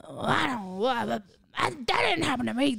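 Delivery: slurred, blustering audience heckler; heckler imitation. Comedian shows friend what heckling is, imitating a heckler. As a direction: interrupt like a clueless audience member. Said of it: "when someone goes like".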